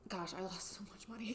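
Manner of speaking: soft dismayed aside; muttered aside. Real, not acted. Rueful aside to viewers.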